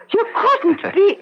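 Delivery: slow, high disbelieving gasp of joy; can't believe her eyes, half laughing; astonished. Acted. Aunt stunned to meet her lost nephew, slow delighted disbelief.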